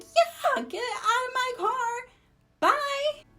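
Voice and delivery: high furious retold yell. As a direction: yell him out of the car. Throwing him out of the car.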